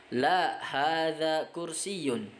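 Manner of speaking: La, hadza kursiyun drawn out slowly; teacher modelling Arabic negative answer; slow model answer. Shown as a demonstration. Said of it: "Tidak, ini adalah kursi".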